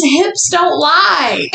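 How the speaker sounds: slow and drawn out; slow drawn-out quote. Retold or imitated. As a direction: stretch each word slowly. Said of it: "And then it says".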